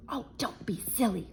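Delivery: second burglar whispers to calm the first. Acted. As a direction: hush your partner in a whisper. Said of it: "whispered the other burglar".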